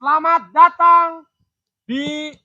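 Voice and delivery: eerie ghost host welcoming you; drawn out, haunting welcome; spooky ghost voice. Pretend.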